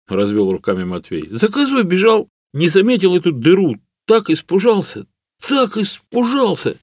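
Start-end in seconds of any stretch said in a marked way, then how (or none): 1.3-6.8 high-pitched peasant voice recounting a scare; excitable servant. A narrator's reading. Hunter tells how chasing a goat ended, excitable servant. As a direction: relive the fright in a high character voice.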